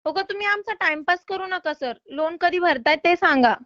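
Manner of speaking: ordinary talk, nothing marked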